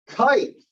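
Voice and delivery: Kite, with the ah-to-ee glide drawn out; stretched kite vowel. Shown as a demonstration. Teacher showing the class the diphthong, gliding it slowly. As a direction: lean on the I in Kite. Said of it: "Now notice the I sound".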